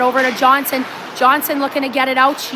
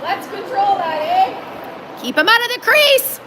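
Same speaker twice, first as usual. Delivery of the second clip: yelling from the sidelines; 7 semitones higher than usual. Real, not acted. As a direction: call it out sharply to the players. Yelling at the players.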